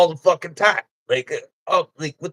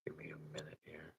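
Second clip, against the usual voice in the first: fast unvoiced whisper, very soft; whispered aside; 32 dB quieter, much faster than usual. Real, not acted. Aside to someone off mic.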